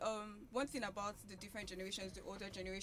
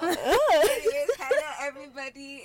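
much louder, laughing outburst to greet listeners; loud exuberant greeting; 3 semitones higher, 17 dB louder than usual. Real, not acted. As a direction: burst in with a big, loud hello. Guest bursts into her greeting.

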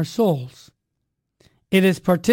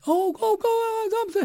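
very high, fading delighted chirps; happy lady; 13 semitones higher, slower than usual. Retold or imitated. Shop lady cheers him on, high and delighted. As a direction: mimic her thrilled urging.

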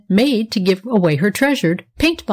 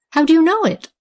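much higher, wide-eyed question; surprised; 9 semitones higher than usual. A narrator's reading. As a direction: ask in sudden wonder. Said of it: "asked Nora in surprise".

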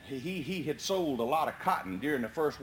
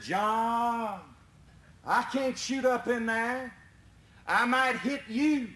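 calling up; 6 semitones higher, slower than usual. Retold or imitated. Barron calling up to John.

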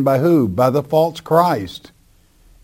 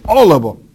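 "All of them" louder and higher; very emphatic; 4 semitones higher, 5 dB louder, faster than usual. Shown as a demonstration. Preacher stressing that the verse spares none, very emphatic "All". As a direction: hit "All" hard, as a firm verdict. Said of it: "Again, that's very emphatic".

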